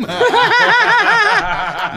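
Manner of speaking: high, loud burst of laughter; cracking up in the middle of the story; a big real laugh that breaks out. Real, not acted.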